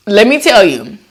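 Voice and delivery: normal talking voice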